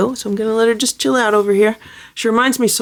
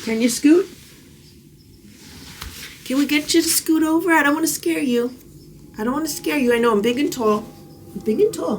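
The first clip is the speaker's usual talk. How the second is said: pet talk; 4 semitones higher than usual. Real, not acted. Coaxing a scared rescue kitten to scoot over, high baby talk. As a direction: coax her gently in a higher voice.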